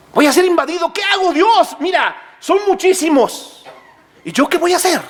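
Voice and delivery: high-pitched, acted alarm; panicked plea to God. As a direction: plead as if about to be invaded. Man pleading to God before invasion.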